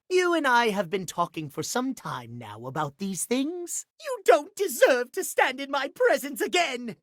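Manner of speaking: ordinary talk, nothing marked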